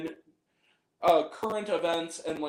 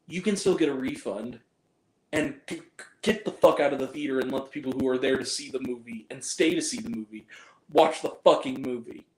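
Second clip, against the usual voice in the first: ordinary talk, nothing marked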